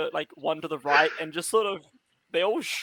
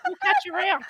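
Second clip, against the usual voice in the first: fast, higher Australian bogan voice; sassy bogan; 11 semitones higher, faster than usual. Acted. Tree dropping its act in a fast Australian bogan voice. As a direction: rattle it off like a cheeky Aussie bloke. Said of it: "Why does he sound like a sassy bogan?".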